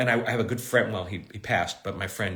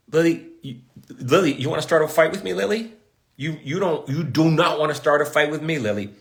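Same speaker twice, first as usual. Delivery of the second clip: louder, higher playful scolding; mock threat; 5 semitones higher, 5 dB louder than usual. Playfully warning Lily not to start a fight, in mock anger. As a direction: tease her like you're picking a fight.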